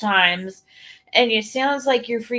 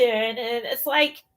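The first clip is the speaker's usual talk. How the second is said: normal talking voice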